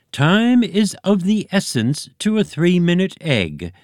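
high, pompous egg leader. A narrator's reading. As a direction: play the chuckling egg chief. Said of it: "said the leader".

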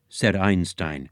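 ordinary delivery, nothing marked

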